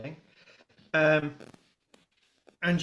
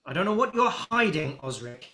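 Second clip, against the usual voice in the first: angry dockmaster; 7 dB louder than usual. Acted. Dockmaster to Osric, rising into an angry shout. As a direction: accuse him harder and harder until you shout. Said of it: "and he goes".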